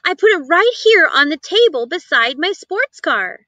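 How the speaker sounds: brushing off her son kindly; mother's voice. A narrator's reading. Mother reassuring her son, lightly voiced.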